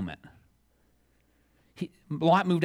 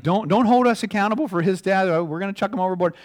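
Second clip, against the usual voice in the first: louder, pleading sailors; crying out; 6 semitones higher, 6 dB louder than usual. Retold or imitated. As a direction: beg heaven loudly, then rush the last line. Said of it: "they're crying out to his God".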